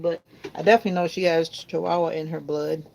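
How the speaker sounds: ordinary talk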